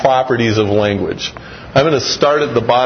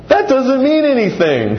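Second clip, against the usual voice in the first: put-on much higher, laughing child voice; father retelling his son's laughing reply; child's voice; 10 semitones higher than usual. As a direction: imitate a laughing four-year-old. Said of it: "he goes".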